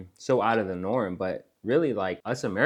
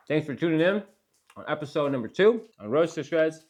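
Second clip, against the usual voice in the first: talking with a mouthful of food; mouth full; 7 semitones higher than usual. Real, not acted. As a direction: speak around a bite of food, mouth full. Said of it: "Sorry for speaking with my mouth full".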